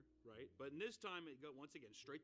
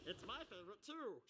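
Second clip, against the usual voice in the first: mock actor quote; 6 semitones higher than usual. Mimics the actor naming his favorite.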